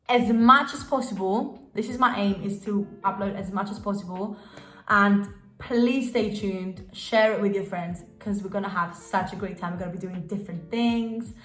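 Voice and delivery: usual speaking voice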